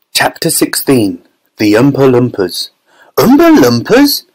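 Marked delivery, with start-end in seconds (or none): 3.2-4.2 very high, louder incredulous question; astonished chorus. A narrator's reading. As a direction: echo it as a baffled crowd would. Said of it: "everyone said at once".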